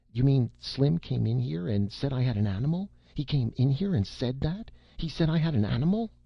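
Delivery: building outraged disbelief; a boy's indignant protest, climbing in pitch. A narrator's reading. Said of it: "recovered indignant breath".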